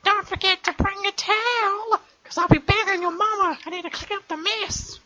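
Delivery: very high cartoon voice; silly high voice. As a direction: squeak it out like a cartoon kid.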